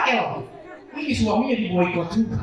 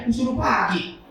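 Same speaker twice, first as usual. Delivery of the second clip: ordinary talk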